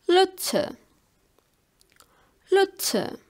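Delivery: German "Lotse", carefully modelling the vowel; vowel pronunciation demo. Shown as a demonstration. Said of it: "It's pronounced E. Like we want to say".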